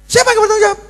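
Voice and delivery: high squeaky retort; husband retorting in a high mock voice. Husband snaps back.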